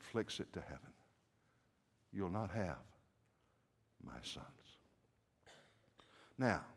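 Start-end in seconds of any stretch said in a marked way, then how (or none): 2.1-4.5 low, soft and halting; quiet defiance. Acted. Rizpah warding buzzards from her sons, quiet defiance. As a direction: let the vow sink almost to nothing.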